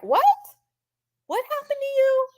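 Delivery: high, baffled mock question; incredulous son. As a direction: squeak it out in mock bewilderment. Imitating son baffled by mother.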